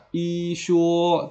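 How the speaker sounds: "I shuo" with both syllables high, long and level; wrong first-tone reading. Shown as a demonstration. Teacher warning students off it, "I shuo" with level first-tone "I". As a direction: hold "I" high and flat before "shuo". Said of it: "Jadi tidak dibaca nada pertama".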